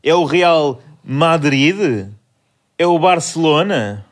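drawn-out quiz guess. Acted. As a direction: stretch each option out like a quiz host. Quiz question to friends.

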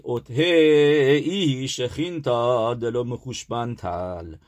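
slow, stretched-out liturgical reading; drawn-out recitation. Shown as a demonstration. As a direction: draw out each word of the prayer. Recites the prayer text being taught.

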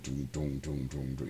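low, flat-toned mechanical doom; mock robot droning; stiff robot voice. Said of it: "robots were just very stiff".

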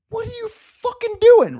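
high furious yell of outrage; angry texted yell. Retold or imitated. Retelling the rude comic's betrayal, a loud outraged yell. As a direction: shout it like you can't believe it. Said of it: "I'm like".

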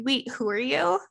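lightly voiced, playing dumb; mock confused. Host imagining brushing off an ex, with light mock confusion. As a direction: pretend not to recognise him at all. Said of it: "I would just be like".

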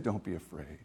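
hushed, low, tender reassurance; calm her fear softly; gentle angel. Retold or imitated. Angel soothing frightened Mary, hushed and gentle. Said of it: "And then he said".

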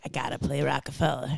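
lightly voiced Baldwin, low and hushed; Alec Baldwin impression. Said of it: "why did I make that his voice?".

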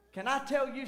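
normal talking voice

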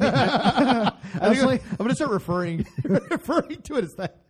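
words squeezed out through laughter; laughing hard, slowed down. Real, not acted. Laughing while talking.

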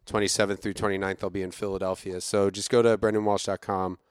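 normal talking voice

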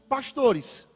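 ordinary talk, nothing marked

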